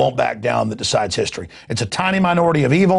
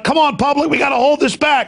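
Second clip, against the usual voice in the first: urgent rallying call; 12 semitones higher than usual. Pretend. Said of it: "and I'm like".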